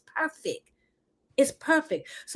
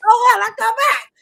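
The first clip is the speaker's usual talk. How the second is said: laughing; 11 dB louder than usual. Real, not acted. Repeats joke laughing.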